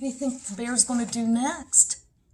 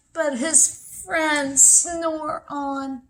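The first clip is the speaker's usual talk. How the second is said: slow storytelling; 4 semitones higher, 6 dB louder, much slower than usual. A narrator's reading. Slow read refrain.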